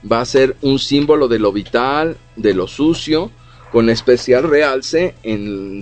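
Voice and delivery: ordinary talk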